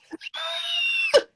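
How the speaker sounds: high, drawn-out mock scream; hammed-up high-pitched scream; werewolf scream. A narrator's reading. Narrator acting out a werewolf screaming, high mock scream. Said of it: "The werewolf screams".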